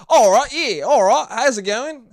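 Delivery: loud, high, exaggerated Aussie greeting; Australian accent. Shown as a demonstration. Podcaster insists he can do it, loud mock Australian accent. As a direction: ham up an Australian hello. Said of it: "I can do a fucking Australian accent".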